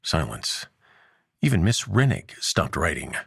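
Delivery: usual speaking voice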